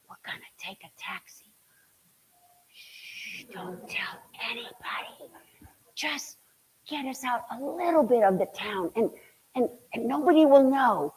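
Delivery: hushed secret plan, barely voiced; conspiratorial whisper. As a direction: whisper it like sneaking off. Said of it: "we said".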